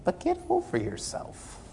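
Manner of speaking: whispered bluntly to the congregation; hushed rebuke. Real, not acted. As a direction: lean in and hush the rebuke. Preacher's blunt advice.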